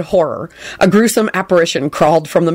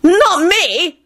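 trembling; 11 semitones higher than usual. Acted. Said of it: "in a trembling voice".

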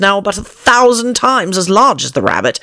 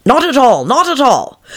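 reluctant Pat; 9 semitones higher than usual. A narrator's reading. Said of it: "whispers now and then".

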